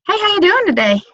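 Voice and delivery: high sing-song hello; cheery greeting. Shown as a demonstration. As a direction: brighten up for a stranger in line. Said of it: "somebody to say".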